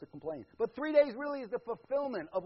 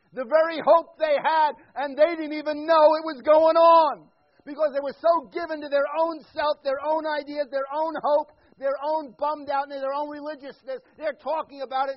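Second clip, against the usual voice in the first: louder, rising preacher's cry; fervent preaching; 7 semitones higher, 15 dB louder than usual. Real, not acted. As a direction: build the sermon to full force. Preaching at full force.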